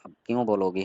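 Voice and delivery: ordinary talk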